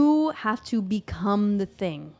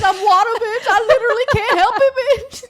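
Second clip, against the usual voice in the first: much higher and louder sassy diva voice, laughing; sassy Cancer moon; 12 semitones higher, 10 dB louder, much faster than usual. Pretend. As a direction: camp it up as a dramatic water sign. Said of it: "You're like".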